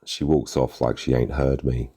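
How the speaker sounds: usual speaking voice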